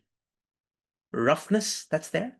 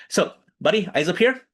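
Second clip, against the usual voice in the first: calling for attention; 4 semitones higher, 5 dB louder than usual. Shown as a demonstration. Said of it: "All eyes on me".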